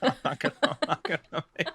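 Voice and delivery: words broken up by laughter; laughing. Real, not acted. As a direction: laugh through the words, pitch jumping high. Laughing at own doomsday joke.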